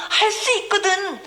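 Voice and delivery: a hint of an anxious father begging; doubtful father pleading. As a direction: beg like a father unsure it can work. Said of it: "이렇게 얘기했더니".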